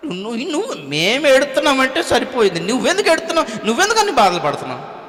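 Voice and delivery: high, jeering taunt at Jesus; mocking thief. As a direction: sneer at him like the thief on the cross. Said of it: "అని అతను కూడా దూషించాడంట".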